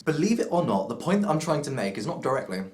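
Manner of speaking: talking through a mouthful of cake; mouth full. Real, not acted. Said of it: "I have golden syrup cake in my mouth".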